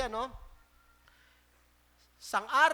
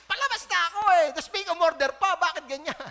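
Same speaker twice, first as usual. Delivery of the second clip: loud, higher hammed-up whine and rant; mock furious complaint; 5 semitones higher, 10 dB louder than usual. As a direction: exaggerate the tired worker's outrage. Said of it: "galit na galit". (kunwaring inis na sigaw)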